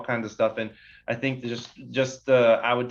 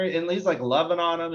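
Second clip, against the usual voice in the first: ordinary talk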